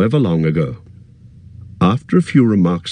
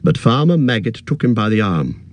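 plain reading voice

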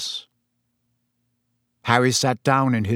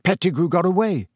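bitter complaint, pitched higher; Harry, bitter; 6 semitones higher than usual. A narrator's reading. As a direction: protest with some bitterness. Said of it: "said Harry bitterly".

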